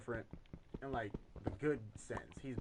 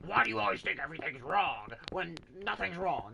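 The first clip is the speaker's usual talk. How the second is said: high, loud retort acting out the coworker; mock the coworker yelling back at a concerned manager; imitated angry yell; 6 semitones higher, 7 dB louder than usual. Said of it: "this man yells at her".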